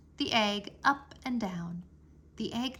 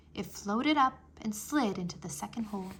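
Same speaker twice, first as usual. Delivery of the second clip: ordinary delivery, nothing marked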